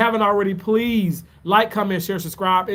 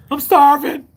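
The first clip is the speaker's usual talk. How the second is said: hammed-up loud whine, high and loud; a playful exaggerated complaint; mock starving; 8 semitones higher, 7 dB louder than usual. Vlogger to chat, faking a starving wail. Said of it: "But I'm really not starving though".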